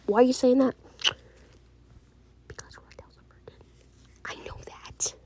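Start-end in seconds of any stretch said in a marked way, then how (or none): 2.5-3.6 real hushed whisper, very soft. Real, not acted. Private aside about someone's virginity. 4.2-5.1 very soft real whisper. Real, not acted. Whispered reply to the private aside.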